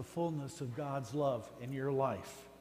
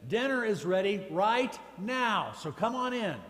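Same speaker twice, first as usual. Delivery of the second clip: calling out; 5 semitones higher, 6 dB louder than usual. Retold or imitated. Dad calls boys in.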